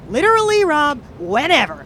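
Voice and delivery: retold eager yes, loud and high; mock over-eager reply, loud and high. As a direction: gush it like you can't believe your luck. Eager reply to a gig offer.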